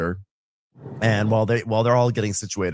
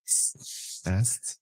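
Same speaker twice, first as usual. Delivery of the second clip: whispered pun; 8 dB quieter than usual. Retold or imitated. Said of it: "get it, guys".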